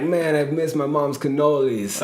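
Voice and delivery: put-on a light, slow New York accent on the client's words; quoting the tough New York client, hinting at his New York accent. [name] imitates his New York client in a mild New York accent. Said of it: "he had a thick New York accent".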